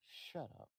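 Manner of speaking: whispered shush; hushing. Acted. Gil with a hand over her mouth, hushing. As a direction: hush her under your breath.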